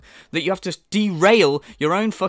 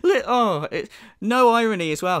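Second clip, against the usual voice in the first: ordinary talk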